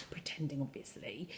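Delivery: very soft, low confiding; whispered aside. Real, not acted. Teacher admitting the pretence to pupils, whispered. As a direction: drop to a whisper.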